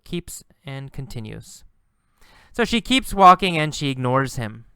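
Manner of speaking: ordinary talk, nothing marked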